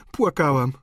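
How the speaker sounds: plain reading voice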